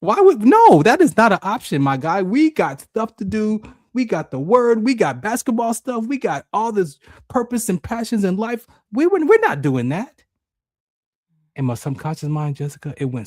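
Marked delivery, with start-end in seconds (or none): 0.0-2.2 high, loud disbelief; outraged middle mind. Retold or imitated. Middle mind rejecting the dark suggestion, loud and incredulous. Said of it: "my middle mind says". 2.2-10.2 high-pitched, insistent argument; protesting middle mind. Retold or imitated. Middle mind listing reasons to live, high and insistent. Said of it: "my middle mind says".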